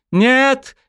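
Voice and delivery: high, loud crying no; tearful scream. A narrator's reading. Weeping dryad screaming as her elder sacrifices herself. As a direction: cry out in despair. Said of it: "кричала вся в слезах".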